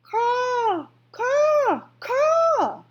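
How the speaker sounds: very high, loud crow caws; screaming crow. A narrator's reading. Crow screams into Edward's ear, very high and loud. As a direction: screech it like a crow. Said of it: "screamed a dark message".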